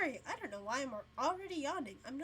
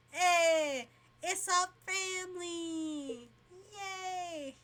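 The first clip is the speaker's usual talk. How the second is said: excited cheer; 6 semitones higher, 6 dB louder, much slower than usual. Acted. Character excitedly greets family.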